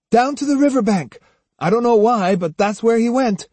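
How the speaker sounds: put-on high, urgent boy's answer; impatient boy. A narrator's reading. Jotaro telling Otsu where Musashi went, impatient and high.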